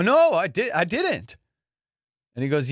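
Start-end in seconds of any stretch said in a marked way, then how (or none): 0.0-1.4 high, loud protest; flustered, stammered denial; guilty denial. Retold or imitated. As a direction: insist on innocence with a raised pitch. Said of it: "my voice, guilty".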